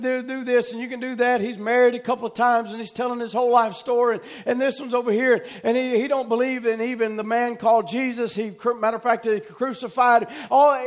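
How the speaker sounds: usual speaking voice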